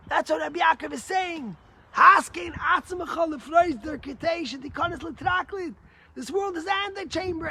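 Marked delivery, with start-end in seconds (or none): 1.9-5.7 louder, higher, preached quote; fervent shout. Real, not acted. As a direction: cry out the verse. Preacher quotes Hebrew text.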